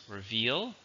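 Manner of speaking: Reveal, softly, stressed on VEAL with a long ee; Reveal, careful model with rising tune; pronunciation demo. Shown as a demonstration. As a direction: say Reveal as re-VEAL, lifting at the end. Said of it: "the pronunciation is very different".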